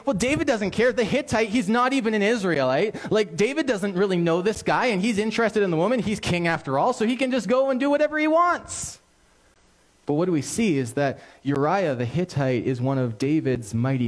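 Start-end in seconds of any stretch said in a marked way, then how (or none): 0.1-9.0 a higher, shrugging voice of the doubter; dismissive thought. Retold or imitated. Listener imagining the king's careless excuse, light mock shrug. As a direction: brush it off as if no big deal. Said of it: "you may be thinking".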